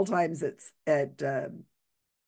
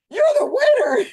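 much higher, louder mock-grand host voice; grand announcement; 8 dB louder than usual. Host presenting drunk with cantaloupe, mock-grand proclamation. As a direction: crown him with ceremony. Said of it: "and he says".

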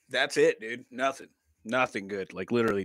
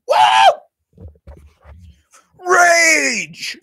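high, loud playful rage cry; mock rage, belted high; hyped-up yell; 13 semitones higher, 12 dB louder than usual. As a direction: let out a big hype shout. Hyping self up as coffee kicks in.

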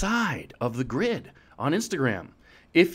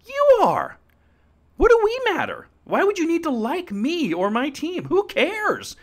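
squeaky exclamation. Pretend. Emphatic high rhetorical questions.

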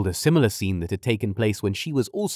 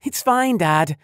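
hurried; 9 semitones higher, 4 dB louder, slower than usual. A narrator's reading. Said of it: "Fiona answered hurriedly".

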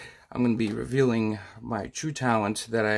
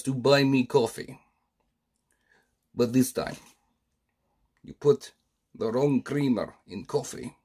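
put-on menacing Russian comrade accent; a stern Soviet boss demanding coffee, in a Russian accent. Soviet boss threatening a comrade over the wrong creamer, Russian accent.

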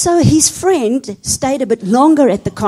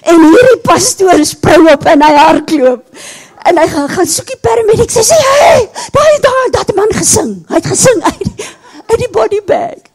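much higher, loud, fast panicked yelling; panicked shout; 9 semitones higher, 7 dB louder than usual. Retold or imitated. Fleeing pastor shouts to the paramedic in panic. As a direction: scream it as you run for help.

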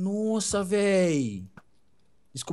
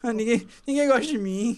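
higher, self-pitying whine; mock drama; 5 semitones higher than usual. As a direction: play the unloved victim, jokingly. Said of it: "ninguém merece drama do Portugal". (drama de coitado, brincando)

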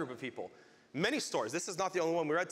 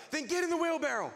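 high, insistent acted call; shouted dare; 12 semitones higher than usual. Walker daring the man to join him, shouting. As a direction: call it out as a challenge, voice pitched up.

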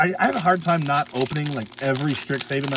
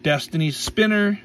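ordinary talk, nothing marked

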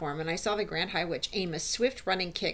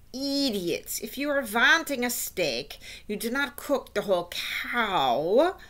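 slow, drawn-out, emphatic witch; scornful witch; 3 semitones higher, slower than usual. Acted. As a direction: scold dim followers, stretching the key words. Voices the Grand High Witch.

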